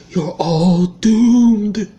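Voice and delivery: slow ominous raspy drawl; creepy prophet of doom, dragged out; Crazy Ralph impression. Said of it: "I'd give it that because I'm actually pretty good at doing voices".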